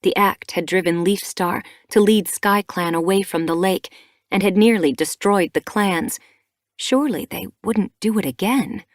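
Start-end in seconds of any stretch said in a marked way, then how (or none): none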